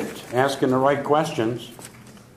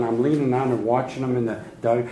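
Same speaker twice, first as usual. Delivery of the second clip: ordinary delivery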